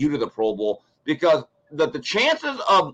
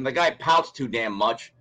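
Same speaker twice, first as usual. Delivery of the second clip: usual speaking voice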